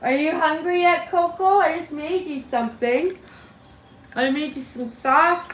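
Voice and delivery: high sing-song coaxing of a pet; pet talk. Real, not acted. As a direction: talk sweetly to a bird. Talking to pet bird Coco.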